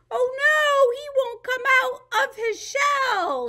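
high, loud playful dismay; playful disappointment for the kids; mock dismay. Teacher pretends the turtle won't come out, high.

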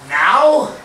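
high, loud incredulous now; mock astonished question; surprised mock teacher. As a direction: act out the teacher's shock. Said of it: "And he goes".

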